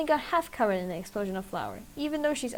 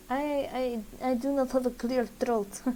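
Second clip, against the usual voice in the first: ordinary delivery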